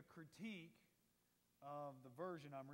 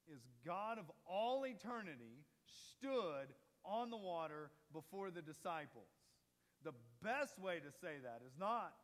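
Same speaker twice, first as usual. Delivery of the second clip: louder preaching, hammering the point home; full-force preaching; 5 dB louder than usual. Acted. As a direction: preach it out to the congregation. Preaching builds to a climax.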